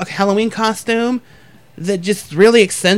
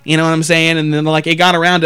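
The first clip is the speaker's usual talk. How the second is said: ordinary talk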